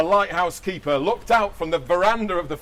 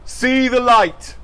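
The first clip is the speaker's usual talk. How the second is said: title announcement; 7 semitones higher, 8 dB louder, much slower than usual. Acted.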